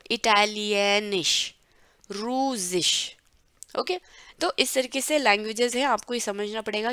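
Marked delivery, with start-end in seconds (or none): 0.1-3.2 इटालियनिश and रूसिश said slowly, each ending in sh; slow recap of words. Shown as a demonstration. Teacher recapping the endings slowly for students. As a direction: draw out इटालियनिश, रूसिश with a clear final sh. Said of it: "इसका उच्चारण एंड में रहता ही रहता है".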